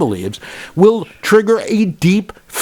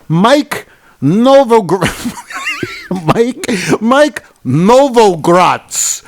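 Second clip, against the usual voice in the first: hammed-up Italian accent, slow and higher; 5 semitones higher, much slower than usual. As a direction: stretch the name out like a mock Italian. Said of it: "with my Italian accent".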